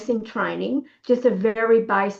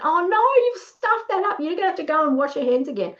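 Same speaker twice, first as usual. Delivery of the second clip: high-pitched exasperated correction; retold telling-off; 7 semitones higher than usual. Nurse correcting an assistant mid-dressing, exasperated retold telling-off. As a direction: blurt a reproach, starting high. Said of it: "And I, just like that".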